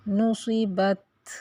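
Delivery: نصبت slow, the ت held still without a vowel; stopped final t. Shown as a demonstration. Teacher reciting نصبت slowly to show the sukoon. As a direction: end نصبت on a silent held ت. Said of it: "تو ہم اس کو".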